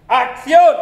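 high, very loud cue; director calling action. Acted. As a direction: yell the cue across the set. Director calls action, acted. (grito agudo de director)

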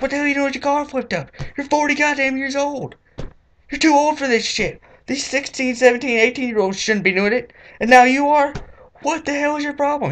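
high, fast indignant scolding; shrieking mock-furious rant; outraged rant. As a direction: tear into the grown man. Scolding forty-year-old store owner.

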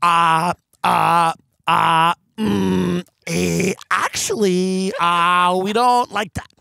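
stammering, whiny mock critic; a flustered mumbling critic caught out. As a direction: hem and haw like a caught hypocrite. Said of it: "they go".

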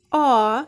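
А held on its own, clearly voiced; А said alone and long; first sound alone. Shown as a demonstration. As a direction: isolate the sound А. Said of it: "Перший звук".

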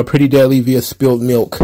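ordinary delivery

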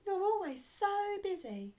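high, loud protesting elf; elf's cry. A narrator's reading. Elf telling busy Santa off, high cry. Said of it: "cried an elf".

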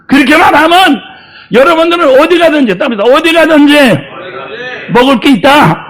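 loud, high fervent sermon shouting; loud pulpit preaching, climbing to shouts; full-force preaching. Acted. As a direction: preach it out to the congregation with fire.

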